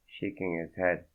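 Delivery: usual speaking voice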